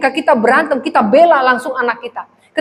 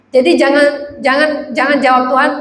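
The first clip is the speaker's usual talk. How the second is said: ordinary delivery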